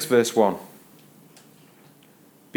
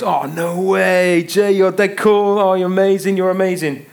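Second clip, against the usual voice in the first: hammed-up awe, stretched out and pitched way up; gushing admirers; 8 semitones higher, 6 dB louder than usual. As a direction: gush like a whole table of amazed friends. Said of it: "And everyone goes".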